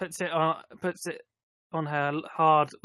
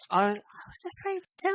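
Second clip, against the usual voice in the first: very high, put-on girl voice; girl's voice; 14 semitones higher than usual. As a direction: say it high like a pretend girl, without shouting. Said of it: "I can't whisper as a girl's voice".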